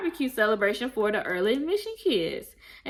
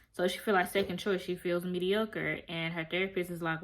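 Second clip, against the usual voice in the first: ordinary talk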